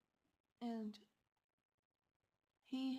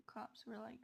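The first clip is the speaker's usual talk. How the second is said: ordinary delivery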